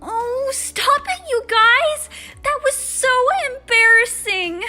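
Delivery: high, loud, drawn-out whine; squealed, mortified protest; embarrassed wail. Acted. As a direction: wail at friends to stop teasing. Teased girl wails at laughing friends.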